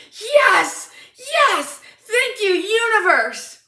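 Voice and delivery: high, loud exultant cheer; triumphant celebration. Retold or imitated. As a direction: celebrate a secret victory. Retold private triumph after elevator encounter.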